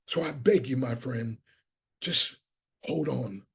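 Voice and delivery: low, soft, heartfelt plea; earnest pleading. Real, not acted. Speaker begging listeners not to give up, soft earnest plea. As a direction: drop low and gently beg them. So I beg you.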